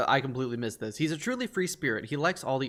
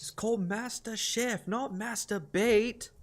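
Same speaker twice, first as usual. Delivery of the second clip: put-on slow, higher British celebrity chef accent; 6 semitones higher, slower than usual. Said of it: "I cannot do a British accent worth shit".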